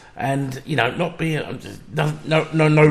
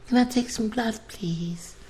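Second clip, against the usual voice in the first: high, soft mock-timid ask; timid nurse; 11 semitones higher, 6 dB quieter than usual. Patient mimicking the nurse taking blood, meek and high. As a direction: ape a meek little nurse. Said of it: "Fucking all timid".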